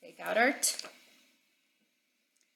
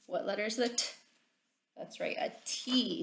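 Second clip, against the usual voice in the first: ordinary talk, nothing marked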